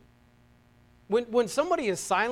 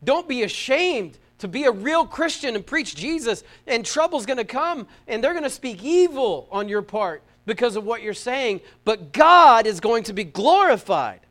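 loud, higher preacher's delivery; impassioned preaching; 5 semitones higher, 9 dB louder than usual. Real, not acted. Preacher urging believers not to be ashamed, impassioned.

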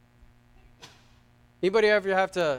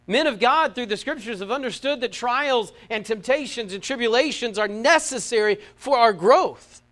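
loud, raised preacher's voice; impassioned preaching; 5 semitones higher, 8 dB louder than usual. Real, not acted. Preacher proclaiming that trials are necessary, impassioned. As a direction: proclaim it with force, slowing on the final words.

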